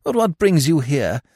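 high, fond mother's voice; mother greeting her son, high and fond; loving mother. Acted.

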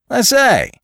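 very high, loud exclamation; jaunty newcomer. Acted. Man walks in on a fight, jaunty surprise.

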